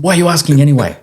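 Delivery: mock annoyed partner snapping back; defensive snap.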